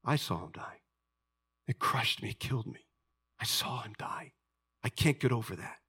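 soft, hurt near-whisper; hushed grief. Acted. Thomas recalling the death to his friends, hushed and hurt. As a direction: let the words sink almost to a whisper. Voicing Thomas grieving, refusing belief.

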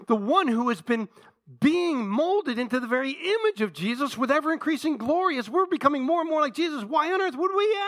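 high, building sermon voice; impassioned preaching. Real, not acted. Preacher pressing the congregation on disowning Jesus, impassioned. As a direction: drive the question upward to a peak.

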